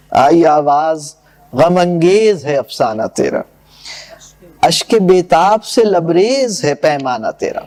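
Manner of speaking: poem recitation. Acted. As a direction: recite the couplets with gravity. Recites verse. (شاعرانہ انداز میں پڑھت)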